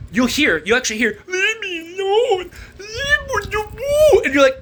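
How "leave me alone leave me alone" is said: high, drawn-out whiny mimicry; mock baby wail; mocking imitation. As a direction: parrot the plea in a stretched childish wail. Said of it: "Why are you mocking me?".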